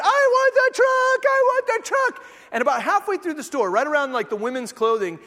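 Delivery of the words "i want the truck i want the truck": high, loud mock tantrum; imitated screaming kid. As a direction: scream it like a toddler being carried out. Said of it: "carrying this screaming kid".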